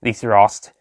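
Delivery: ordinary delivery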